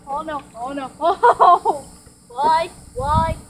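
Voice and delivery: ordinary delivery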